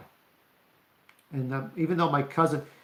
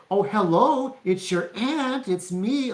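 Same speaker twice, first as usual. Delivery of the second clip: high, drawn-out singsong aunt voice; mock a relative's strange, stretched-out hello; imitated aunt's odd greeting; 6 semitones higher, 3 dB louder, slower than usual. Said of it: "why are you talking to me this way".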